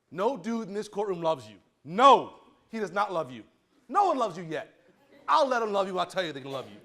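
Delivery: real heated, emphatic lecturing; worked-up warning, hitting the refusals hard; lathered up. Real, not acted. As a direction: lay down the law with punchy, loud stresses. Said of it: "I'm getting all lathered up".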